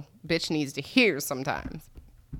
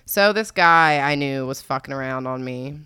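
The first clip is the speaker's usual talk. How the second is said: ordinary talk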